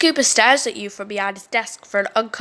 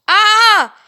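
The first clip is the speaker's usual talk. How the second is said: pained cry; 9 semitones higher, 7 dB louder than usual. Acted. Ben's arm pops.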